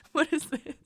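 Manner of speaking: ordinary delivery, nothing marked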